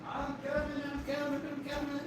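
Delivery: distant call from outside, faint; off-mic call from the van; called from far off. Real, not acted. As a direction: call back from across the yard.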